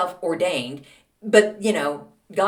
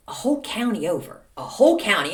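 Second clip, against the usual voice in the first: normal talking voice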